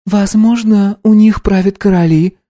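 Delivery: put-on a bright young girl; girl's voice. A narrator's reading. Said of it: "предположила Настя".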